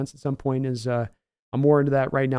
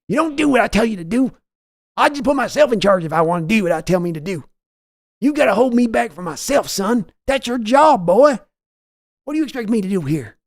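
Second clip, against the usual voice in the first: high, loud bellow of an angry team owner; Jerry Jones yelling; 8 semitones higher, 5 dB louder than usual. Retold or imitated. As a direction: yell for your son like a furious boss. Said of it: "your Jerry Jones voice yelling at Steven".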